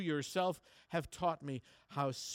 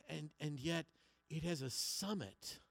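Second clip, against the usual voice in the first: ordinary talk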